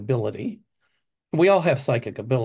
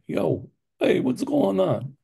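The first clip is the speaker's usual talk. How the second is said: put-on Italian Chicago accent; 5 semitones higher, slower than usual. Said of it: "my Italian Taylor Street character".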